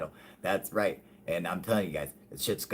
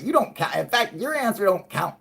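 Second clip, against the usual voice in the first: mock outrage; 13 semitones higher, 8 dB louder than usual. Teasing viewer Tony.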